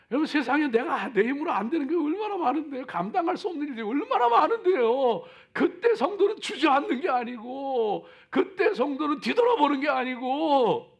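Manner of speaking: loud, high, urgent sermon; booming motivational speech. Acted. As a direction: preach it out full force. Preaching at full force.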